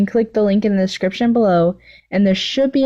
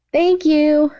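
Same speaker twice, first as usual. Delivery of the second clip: put-on high, sing-song girly voice; a sweet, high obsessive schoolgirl; gushing villain girl; 9 semitones higher than usual. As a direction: play the clingy yandere girl, all sugar. Toga voiced in read story.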